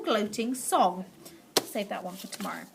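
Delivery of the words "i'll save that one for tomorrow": quiet aside. Real, not acted. Narrator closes quickly, softly.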